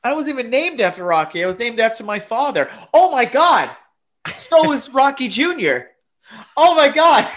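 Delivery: high, loud, frantic excitement; shrieking in mock panic; freaking out. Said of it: "I'm like".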